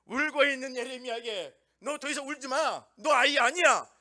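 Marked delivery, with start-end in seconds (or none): 1.8-3.9 raised voice, louder and higher, telling him to stop crying; a loud, commanding God, no more tears; firm divine command. Acted. God rebukes weeping Jeremiah, loud.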